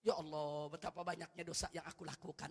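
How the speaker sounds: humble prayer. Shown as a demonstration. Preacher models a sinner confessing before God, hushed and humble. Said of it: "akui di hadapan Allah".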